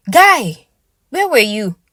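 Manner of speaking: sharp high call of his name; high, loud call across the room; calling out sharply. Acted. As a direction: snap him out of it. Snapping friend back to reality.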